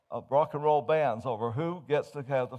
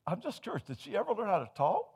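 curious shopkeeper; 8 semitones higher, faster than usual. Acted. Owner voice, curious.

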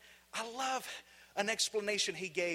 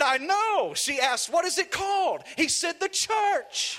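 retold excitement; 9 semitones higher, 7 dB louder than usual. Retold punchline of the anecdote.

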